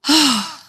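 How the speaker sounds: acted sigh of a relieved storybook woodpecker. A narrator's reading. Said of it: "sighed Spotty, relieved".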